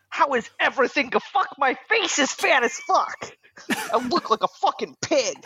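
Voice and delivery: run-on text message performed as its writer, slowing midway; Dan voice. Acted. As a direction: read a friend's text in his exact voice. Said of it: "please read it in the Dan voice".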